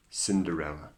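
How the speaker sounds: plain reading voice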